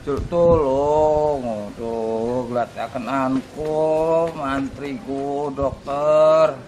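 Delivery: weak and hurting. Acted. Weak pleading, hurt.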